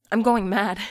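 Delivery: frightened cry that she is losing her mind; panicky. A narrator's reading. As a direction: blurt it out in alarm. First, panicked version.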